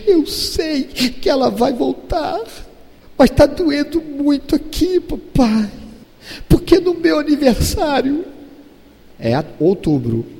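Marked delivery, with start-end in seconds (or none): 0.1-8.3 father mimics his daughter missing her sister; imitating tearful daughter. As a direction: mimic a girl aching inside. Father voices crying daughter.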